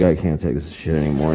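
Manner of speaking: low, worn-out groan of a defeated parent; exhausted parent voice. Retold or imitated. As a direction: let it sag like a collapsed mom. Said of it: "the parent's like".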